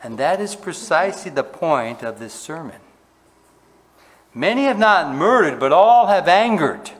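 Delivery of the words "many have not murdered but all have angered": preaching at full force. Acted. Preacher drives home the point.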